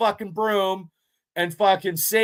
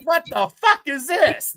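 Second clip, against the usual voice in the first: higher, slower, recoiling in disgust; a horrified what-the-hell; disgusted shock; 8 semitones higher than usual. Retold or imitated. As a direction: react to the rat like it's gross and unbelievable. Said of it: "I was like".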